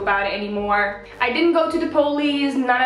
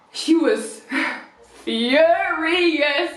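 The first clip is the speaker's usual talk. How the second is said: laughing; 5 semitones higher, much slower than usual. Real, not acted.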